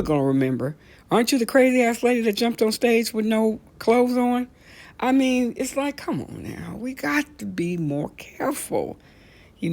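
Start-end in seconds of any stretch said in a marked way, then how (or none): 1.1-4.5 mock taunt. Mocking people teasing crazy lady.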